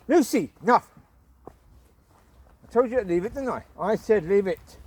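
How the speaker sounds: loud, firm telling-off; cross owner at her dog; scolding the dog. Real, not acted.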